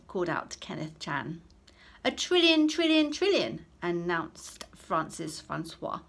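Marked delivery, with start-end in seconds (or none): 2.0-3.7 high, loud triumphant cry. Acted. Pupil sure he has topped everyone, triumphant. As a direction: crow it like you've won. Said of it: "in a triumphant tone".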